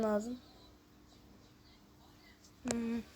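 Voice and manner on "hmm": ordinary delivery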